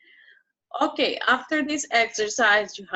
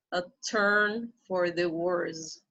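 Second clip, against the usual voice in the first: slow model reading; slower than usual. Shown as a demonstration. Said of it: "slow model reading".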